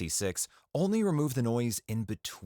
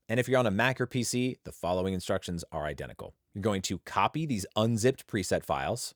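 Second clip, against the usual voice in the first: normal talking voice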